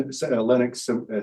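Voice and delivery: usual speaking voice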